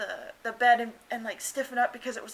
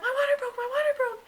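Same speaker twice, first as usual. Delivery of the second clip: high, loud, urgent repeating; excited announcement; 5 dB louder than usual. Retold or imitated. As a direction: blurt it out in a thrilled rush. Repeating that her water broke.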